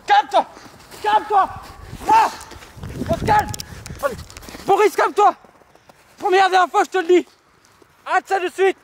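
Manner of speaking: high, loud yelling in a mock struggle; hammed-up panicked shouting; frantic shout. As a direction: scream at him to stop.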